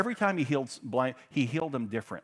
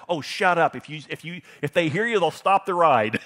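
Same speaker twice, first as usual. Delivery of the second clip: loud, raised-pitch impatient retort; scolding older sister; 6 semitones higher, 9 dB louder than usual. Retold or imitated. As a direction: imitate a sister shutting down the complaints. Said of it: "And her sister said".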